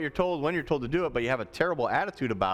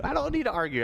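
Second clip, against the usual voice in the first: higher-pitched mock exasperation; acted teacher's protest, pitched up; exasperated teacher; 9 semitones higher, much faster than usual. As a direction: push back at an arguing pupil. Teacher answers arguing student.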